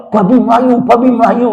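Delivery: mild, high comic calling out; imitated shouting. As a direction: mimic an alarmed yell without much volume. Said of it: "Teriak-teriak dia".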